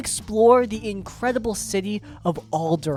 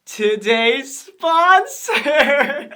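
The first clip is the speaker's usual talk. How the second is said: drawn-out, much higher, louder announcement; hammed-up sponsor reveal; 7 semitones higher, 7 dB louder, much slower than usual. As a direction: sell the sponsor like a game-show host. Segue into the sponsor read.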